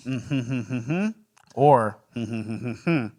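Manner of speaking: wordless hummed stand-in for words; censoring hum. Real, not acted. Hiding a disgusting choice from listeners, coy censoring hum. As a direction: hum over the unsayable bit in short pulses.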